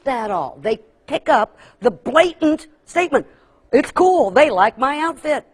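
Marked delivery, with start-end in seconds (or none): 3.7-5.5 put-on higher, louder, eager child taking it as praise; delighted and oblivious to the sarcasm; naively pleased. Sixth grader proud of the new outfit, naively excited.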